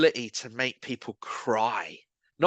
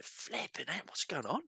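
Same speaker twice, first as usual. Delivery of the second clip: high, soft retold astonishment; hushed alarm; 6 semitones higher, 13 dB quieter than usual. Team leader spotting a man in a puddle, hushed alarm. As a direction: act out shock under the breath.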